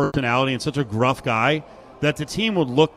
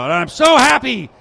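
mock-elated coach, slowed and drawn out; overjoyed coach's postgame line, lightly mimicked; imitating giddy joy; 10 semitones higher, 6 dB louder, slower than usual. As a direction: ham up her happiness, higher and louder. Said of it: "It's like, uh, I, you know".

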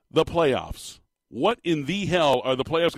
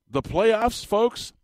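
excited; 8 semitones higher, much slower than usual. Real, not acted. Host hyping the coming playoffs.

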